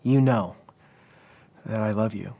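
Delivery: understated wounded reply, broken by a long pause; hurt. Retold or imitated. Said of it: "But Peter was hurt".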